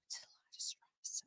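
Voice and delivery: whispered aside. Real, not acted. Host whispering about having too many moms, whispered. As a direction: breathe it out under your breath.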